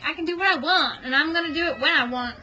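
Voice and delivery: put-on sassy teenager. Cat as teenager to her owner, mock defiant voice. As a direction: voice a cat as a bratty teen. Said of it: "She's a teenager now, so she's like".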